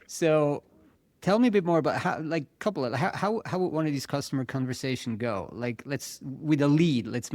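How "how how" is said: usual speaking voice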